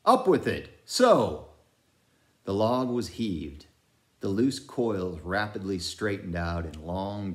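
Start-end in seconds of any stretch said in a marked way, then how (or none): 0.1-1.5 command. A narrator's reading. Repeated order.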